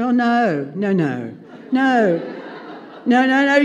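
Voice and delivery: flustered denial. Retold or imitated. Nervous Karen denying nerves.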